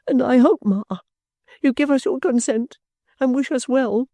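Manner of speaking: tearful plea. A narrator's reading. Caddy pleading tearfully.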